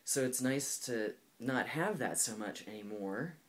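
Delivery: ordinary delivery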